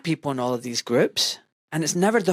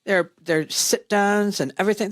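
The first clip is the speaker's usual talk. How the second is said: normal talking voice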